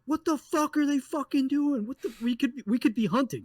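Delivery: high, agitated outburst; fired up and indignant; a hammed-up angry rant; imitating his irritated reaction. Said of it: "my initial reaction was go".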